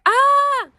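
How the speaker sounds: high, loud delighted "ah!"; excited cry. Real, not acted. Hearing a friend met her idol, excited. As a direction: burst out with real delight. Reacting to friend's collab news.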